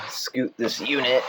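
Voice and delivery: ordinary delivery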